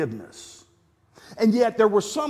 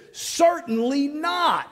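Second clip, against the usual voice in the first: slow "Certainly not" with firm, stretched "not"; emphatic; 6 semitones higher, slower than usual. Shown as a demonstration. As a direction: draw out each word, land on "not". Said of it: "Paul emphatically states".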